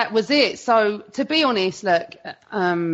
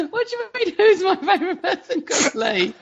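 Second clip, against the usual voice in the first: laughing; 11 semitones higher than usual. Real, not acted. Guest laughing at the host's cheeky question. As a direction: laugh your way through the question. Asked about favourite Lee.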